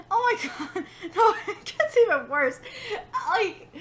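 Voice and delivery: loud words bursting through laughter; real giggling fit breaking up the sentence; laughing hard. Real, not acted. Reader admitting it gets worse, laughing hard. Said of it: "I'm laughing so hard".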